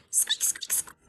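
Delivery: breathy whispered mouse squeaks. Acted. Reading the mouse's squeaks aloud, whispered.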